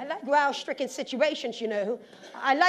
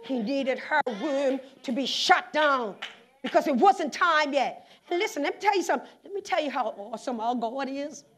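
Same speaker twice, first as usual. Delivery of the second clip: ordinary talk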